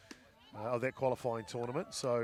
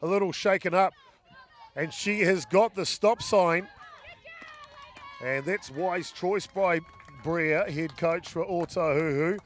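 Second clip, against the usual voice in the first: loud, rising live sports call; excited play-by-play; 6 semitones higher, 9 dB louder than usual. Real, not acted.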